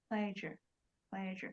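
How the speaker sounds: Pleasure with the soft zh sound as in Asia. Shown as a demonstration. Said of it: "Cái âm này này là cái âm ch". (đọc Pleasure với âm giữa như trong Asia)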